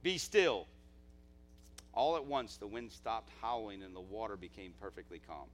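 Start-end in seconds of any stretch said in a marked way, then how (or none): 0.0-0.7 loud, high burst on the first word, then easing; commanding yell, acted from scripture; shouted command. A narrator's reading. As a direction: shout down a storm. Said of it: "shouted to the sea".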